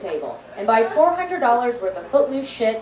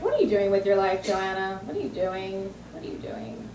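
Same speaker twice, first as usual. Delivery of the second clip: softer and fading to a hush; self-reproach; 3 semitones lower, 7 dB quieter than usual. Retold or imitated. Joanna scolding herself alone, softer and fading. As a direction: mutter it to yourself in despair. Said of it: "I'm like".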